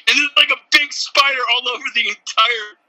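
ordinary delivery

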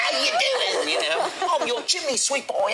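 hammed-up high Cockney chimney sweep; mock Cockney accent. Host mocking Americans faking Cockney, high hammed-up accent. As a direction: play a bad American doing Cockney, high and cheery. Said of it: "Mary Poppins kind of".

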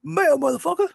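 squeaky put-on yell; movie quote voice.

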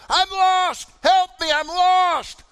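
high, loud desperate cry; screaming for help. Retold or imitated. Lost young camper answers the counselors, screaming for help. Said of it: "was down there screaming".

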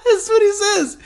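very high, squeaky laughing speech; laughing hard. Real, not acted. Telling listeners what classmate says, helpless laughter. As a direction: squeeze the words out through laughter. Cracking up after the imitation.

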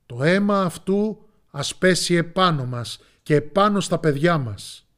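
the people answer Pilate in a loud roar; crowd shouting. A narrator's reading. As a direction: shout it all together as the crowd. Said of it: "Και εκείνοι είπαν".

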